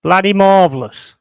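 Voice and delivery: loud sarcastic outburst. Real, not acted. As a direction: bellow it with mock praise.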